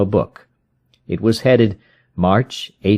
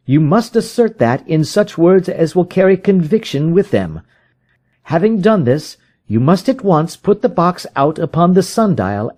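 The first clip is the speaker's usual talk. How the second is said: higher, pressing detective's voice; urgent Holmes; 10 semitones higher than usual. A narrator's reading. Holmes urges the frightened visitor, higher and pressing. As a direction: rouse a despairing man to action.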